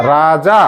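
রাজা with two long "aa" vowels. Shown as a demonstration. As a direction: stretch রাজা syllable by syllable. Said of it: "আকার আছে".

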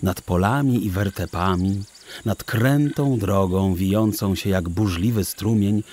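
plain reading voice

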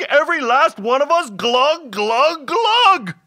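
high, loud wailing rant, peaking on the final word; hammed-up howl of doom; howling hobgoblin. A narrator's reading. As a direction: pile up the disaster in a frantic creature wail. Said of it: "the hobgoblin howled".

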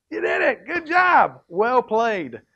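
high, loud cheering; excited praise. Real, not acted. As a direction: cheer them on. Cheering the kids.

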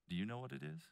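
soft, low, probing question; little inner voice. Retold or imitated. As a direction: ask it gently, like a quiet voice within. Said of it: "I heard this little voice inside of me say".

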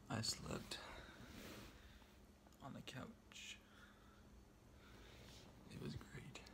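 soft unvoiced whisper. Real, not acted. Evacuated at his aunt's house at night, real hushed whisper. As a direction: hush it so nobody wakes.

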